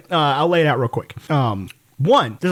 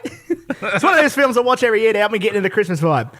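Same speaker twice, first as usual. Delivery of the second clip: laughing mock Australian read, higher; Australian accent read with a laugh; 4 semitones higher than usual. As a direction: put on an Aussie voice while cracking up. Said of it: "you have to do the accent".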